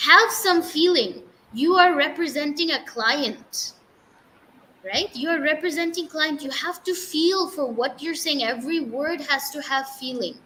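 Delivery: strict teacher. Retold or imitated. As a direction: imitate a teacher insisting, easing off towards the end. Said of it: "She was a very strict teacher".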